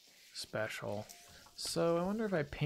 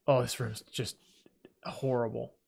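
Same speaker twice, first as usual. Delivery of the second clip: ordinary talk, nothing marked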